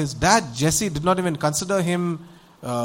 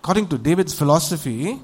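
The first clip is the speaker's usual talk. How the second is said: normal talking voice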